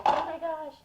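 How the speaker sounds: put-on high, loud worried child's wail; panicked child. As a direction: panic like a kid who just dropped the plate. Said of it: "I was so upset and I was so sad".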